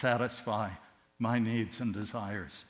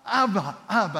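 put-on high, loud, delighted cry of a little girl; joyful child calling; 9 semitones higher, 9 dB louder than usual. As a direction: call out to daddy with pure joy, much higher and louder. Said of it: "and she went".

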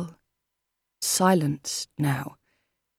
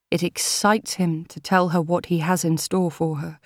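plain reading voice

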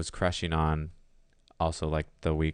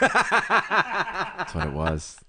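laughing; 13 semitones higher, 4 dB louder, much slower than usual. Real, not acted. Laughing punchline.

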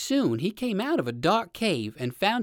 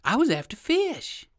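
pleading rabbit; 9 semitones higher than usual. A narrator's reading. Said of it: "said the rabbit".

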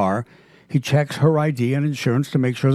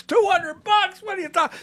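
shocked outrage. Retold or imitated. Woman hearing the locksmith's new fee, mock shocked. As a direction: squeal it in disbelief at the price.

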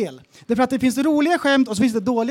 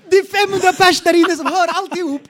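laughing retelling; 7 semitones higher, 5 dB louder than usual. Retold or imitated. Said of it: "laughing in the silence".

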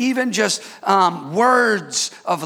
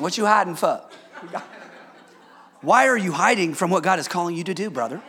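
mock interpretation; 3 semitones lower, faster than usual. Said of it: "Tongues and interpretation".

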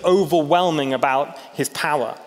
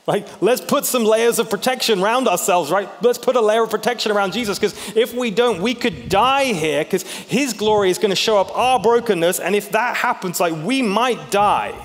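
panicked; 6 semitones higher than usual. Retold or imitated. Peter panicking about God's glory.

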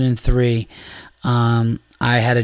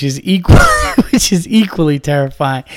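laughing; 2 semitones higher, 4 dB louder than usual. Real, not acted. Cracking up at the story.

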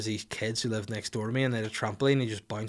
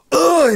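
sudden piercing frustrated scream; scream into the mic; 16 dB louder than usual. Real, not acted. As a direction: let out a full raging scream. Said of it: "Sorry for screaming into the mic".

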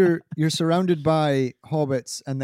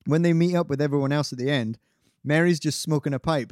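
ordinary delivery

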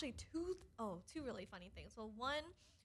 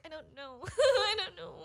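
laughing; 7 semitones higher, 10 dB louder than usual. Retold or imitated. Flustered reply to friend's question.

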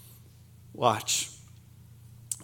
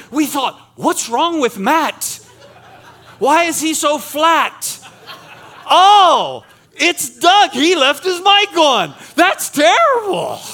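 retold teasing; 6 semitones higher, 6 dB louder, slower than usual. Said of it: "they said".

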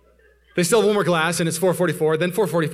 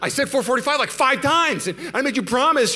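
high, raised-voice insistence; retold heated arguing; 9 semitones higher, 4 dB louder than usual. Husband insisting to wife on the promise, acted heated protest. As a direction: argue back high and indignant. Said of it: "we're just yelling and talking very passionately".

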